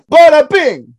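loud mobster catchphrase. Pretend. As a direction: shout it like a mob boss. Godfather catchphrase shouted.

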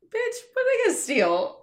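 high, loud, laughing disbelief; incredulous shout. Real, not acted. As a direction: squeal it out in amused disbelief. Mock outrage at stealing fears.

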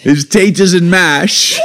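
high, slow mock London accent. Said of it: "your best London accent".

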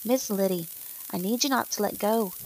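plain reading voice

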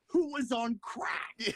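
high-pitched, drawn-out sportscaster impression; Stephen A. Smith. Co-host quoting a sports pundit, mock-incredulous impression. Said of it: "In the wise words of Stephen A. Smith".

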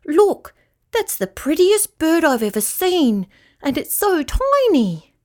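excited storybook rat. A narrator's reading. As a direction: marvel at something tiny. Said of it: "said Rat excitedly".

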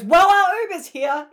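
high, loud mock announcement; hammed-up urgent exit line; imitating abrupt escape. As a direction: cut in loudly to get away. Acts out Lily's abrupt escape.

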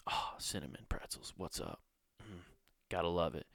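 hushed, savoring whisper to the pretzels; soft unvoiced murmur of delight; dreamy whispered aside. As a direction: breathe it like a lover greeting food. Savoring mall smells, whispered aside.

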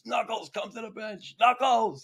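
high, strained yell to a teammate; imitated yell. Mario yells a dazed teammate back to the bench, yelling. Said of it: "And Mario yelled".

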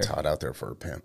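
quiet. Real, not acted. Quoted song line, said low.

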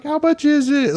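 high-pitched imitation of a mother paying up; imitated flustered mom. Mom digging in her purse, imitated flustered voice.

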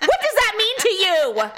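high-pitched indignant demand; mock outrage. Customer to a driver who knocked, mock outraged scolding.

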